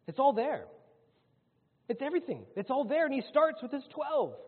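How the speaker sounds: high, loud, insistent preacher's climax; forceful preaching. Acted. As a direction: drive it home from the pulpit, pitched high. Preacher builds to an emphatic high point.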